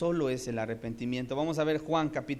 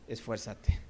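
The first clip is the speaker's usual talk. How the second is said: low, firm "Esfuérzate"; a hint of a stern teacher; lightly voiced Jesus; 4 semitones lower, slower than usual. Preacher voicing Jesus urging the man, lightly lowered. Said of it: "Jesús dijo".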